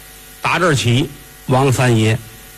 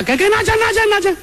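much higher, rushed give-it-here voice; hasty rich master. Acted. Young master hurriedly handing the madam money, high and rushed. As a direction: fling the money at her, high and quick.

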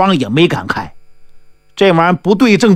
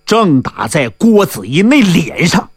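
usual speaking voice

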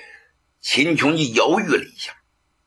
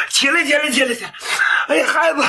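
eager urging; 12 semitones higher, much faster than usual. Acted. Prince raising kneeling nephew.

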